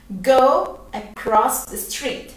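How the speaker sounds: slow, clear teaching repetition; patient teacher modelling the line; slow model phrase. Shown as a demonstration. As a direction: Go across the street said very slowly. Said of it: "¿Cómo digo eso en inglés?".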